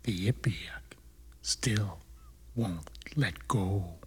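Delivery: very low, hushed refrain; spooky poem recital. Acted. Narrator telling of the creature that held on, deep hushed refrain. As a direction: sink to a deep, soft murmur.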